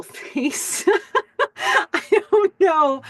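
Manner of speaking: real laugh breaking up the words, slow and high; can't hold it together, giggling through it; cracking up mid-sentence. Real, not acted. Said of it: "I'm so sorry".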